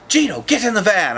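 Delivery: high, loud call to get in the van; a stern mom calling a kid; mock foster mom. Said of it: "she goes".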